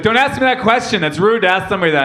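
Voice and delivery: high, rapid indignant scolding; mad dog owner. Retold or imitated. Angry dog lady scolding questioner in line, indignant. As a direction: rattle off a mock-outraged rebuke. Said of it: "so fucking mad".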